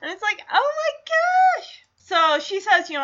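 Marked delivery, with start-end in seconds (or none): 0.5-1.8 high, slow, amazed oh my gosh; drawn-out amazement; amazed gasp. Retold or imitated. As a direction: gasp it out in wonder. Reacting to Kate behind him.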